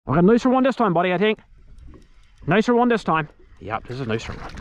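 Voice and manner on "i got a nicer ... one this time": excited call-out. Real, not acted. Excited after landing a bigger fish.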